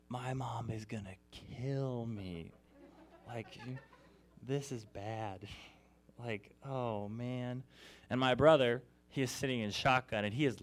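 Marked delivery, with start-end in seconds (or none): 0.1-7.6 slow, hushed, worried murmur; sinking dread, drawn out slowly; quiet dread. Retold or imitated. Pulled over by police, dreading his mom's reaction, slow hushed dread.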